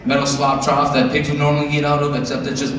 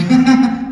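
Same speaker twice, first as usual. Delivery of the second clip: put-on child giggle; 10 semitones higher, 5 dB louder than usual. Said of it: "she went".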